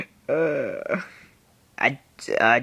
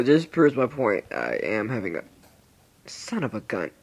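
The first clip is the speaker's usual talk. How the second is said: ordinary talk, nothing marked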